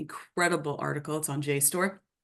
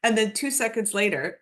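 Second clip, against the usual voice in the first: ordinary delivery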